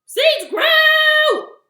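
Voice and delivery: high, very loud frustrated tirade; mad yelling. Acted. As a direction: yell at the seeds with drawn-out cries. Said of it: "he yelled and yelled and yelled".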